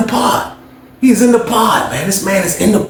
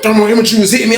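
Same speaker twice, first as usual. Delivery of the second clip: usual speaking voice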